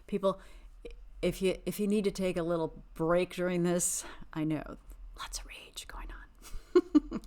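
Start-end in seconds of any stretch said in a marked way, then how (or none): 5.2-7.3 slow, high, airy whisper. Real, not acted. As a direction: hush it out slowly like a secret. Joking about viewers' rage.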